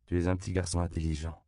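ordinary delivery, nothing marked

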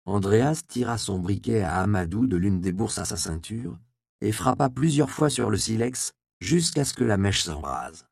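plain reading voice

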